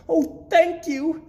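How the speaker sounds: high chirpy thanks; chirping. A narrator's reading. Gertrude chirping thanks to her uncle, high and bright. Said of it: "chirped Gertrude".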